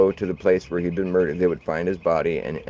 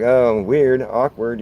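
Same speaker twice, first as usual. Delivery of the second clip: higher, louder mock cringe; a hint of a startled widow, higher and louder; mock awkward; 5 semitones higher, 8 dB louder, much slower than usual. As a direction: play the widow's uneasy "weird". Widow reacts to the boy.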